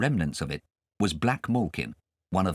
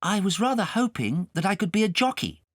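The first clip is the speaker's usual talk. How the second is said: put-on timid boy; 12 semitones higher than usual. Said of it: "Kay said timidly".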